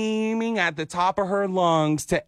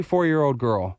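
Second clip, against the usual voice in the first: ordinary talk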